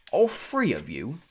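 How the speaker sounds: slow drawn-out British character voice; British-like accent. A narrator's reading. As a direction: put on an odd, foreign-tinged British accent. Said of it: "He had a strange accent. British maybe".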